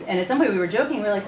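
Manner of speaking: normal talking voice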